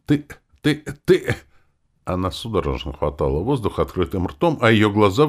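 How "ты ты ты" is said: spluttering outrage. Acted. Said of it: "задохнулась от возмущения".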